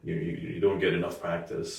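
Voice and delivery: ordinary talk, nothing marked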